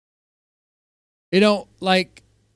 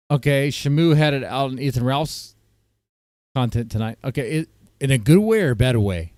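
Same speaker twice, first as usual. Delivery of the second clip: a little tipsy, relaxed and loose. Real, not acted. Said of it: "I am a little tipsy".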